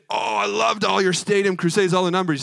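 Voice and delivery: a put-on Billy Graham. Imagined Billy Graham praising crusades.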